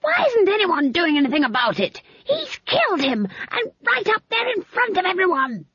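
put-on very high, loud indignant old woman; outraged rage. A narrator's reading. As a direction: screech it in scandalised fury. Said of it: "bouncing up and down on her stool with rage".

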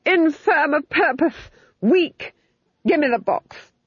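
high, angry Duke berating a servant; furious berating. A narrator's reading. Duke's screamed tirade at the fool.

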